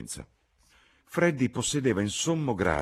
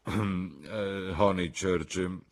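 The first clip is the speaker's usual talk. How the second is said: a lightly put-on, slow gentleman's voice; a poor imitation of Sir Harry; a hint of a stuffy old gentleman; mediocre Sir Harry impression; 3 semitones higher, much slower than usual. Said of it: "Freddy era un imitatore mediocre".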